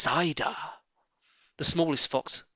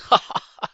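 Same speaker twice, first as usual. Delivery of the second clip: short breathy laugh; a quick amused chuckle; Mr Fox laughing; 9 dB louder than usual. A narrator's reading. Mr Fox laughing as the rat flees. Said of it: "Mister Fox laughed".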